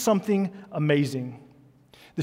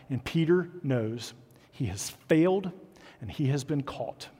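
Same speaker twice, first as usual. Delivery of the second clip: usual speaking voice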